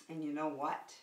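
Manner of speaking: ordinary talk, nothing marked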